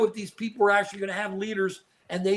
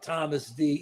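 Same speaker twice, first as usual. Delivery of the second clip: ordinary talk, nothing marked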